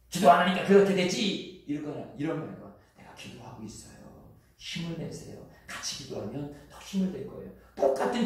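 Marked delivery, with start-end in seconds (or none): 0.1-1.6 loud, high scolding snap; harsh rebuke. Retold or imitated. Pastor voicing a harsh churchgoer blaming someone, loud scolding. 3.0-7.5 soft, hushed and tender; gentle encouragement. Retold or imitated. Pastor voicing a gentle friend encouraging prayer, soft and tender.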